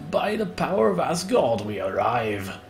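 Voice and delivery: rising heroic call on power, settling down; By the power of Asgard proclaimed with swelling pride; grand battle cry. Acted. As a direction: proclaim it like a warrior god. Announcing the raid arrival.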